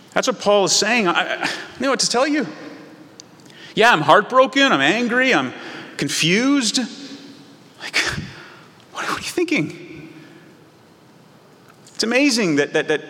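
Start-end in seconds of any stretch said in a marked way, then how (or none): 1.1-10.1 high, breathless, trailing into a whisper; speechless parent. Acted. Parent to child after the mess, high and speechless.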